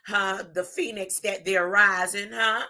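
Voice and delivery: ordinary talk, nothing marked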